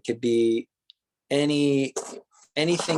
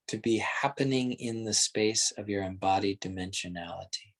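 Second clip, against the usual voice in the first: guided meditation; 3 semitones lower than usual. Acted. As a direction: lead them gently inward, taking your time.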